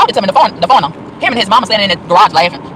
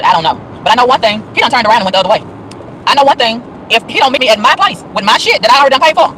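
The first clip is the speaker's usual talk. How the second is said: ordinary talk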